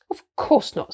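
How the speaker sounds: mildly scandalised denial; very shocked. A narrator's reading. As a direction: protest as if deeply shocked. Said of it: "in a very shocked voice".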